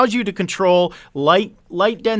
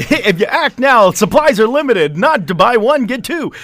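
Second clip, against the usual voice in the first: loud, eager pitchman hype; over eager infomercial; 4 dB louder than usual. Pretend. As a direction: sell it like a TV pitchman. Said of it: "we're going into infomercial mode here".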